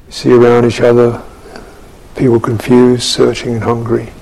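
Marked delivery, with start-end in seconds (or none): none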